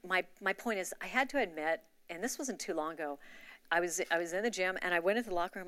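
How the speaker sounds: ordinary talk, nothing marked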